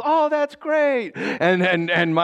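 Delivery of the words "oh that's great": high, drawn-out mock delight; forced enthusiasm. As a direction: gush with put-on cheer. Forced cheer to relative.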